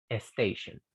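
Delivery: "station" said as "estation", softly; wrong added e. Shown as a demonstration. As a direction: tack an e onto "station". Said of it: "Y eso está mal". ("station" con e inicial, mal dicho)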